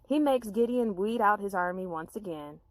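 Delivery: ordinary delivery, nothing marked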